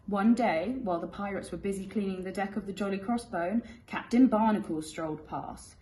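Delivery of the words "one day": ordinary delivery, nothing marked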